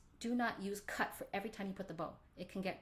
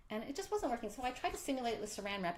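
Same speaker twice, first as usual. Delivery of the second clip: ordinary talk, nothing marked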